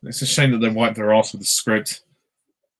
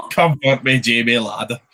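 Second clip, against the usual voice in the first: bad Scottish accent. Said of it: "that is a bad, like, Scottish accent".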